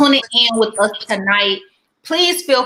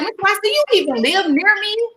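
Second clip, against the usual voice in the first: mock surprise; 9 semitones higher than usual. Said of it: "I'm like".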